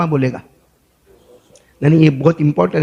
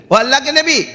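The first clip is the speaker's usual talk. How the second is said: raised, high-pitched hail; calling out; 8 semitones higher than usual. Acted. Passenger hailing the prophet from behind, urgent high call. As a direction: call after someone walking away, pitched high. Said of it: "تو اس نے آواز دی".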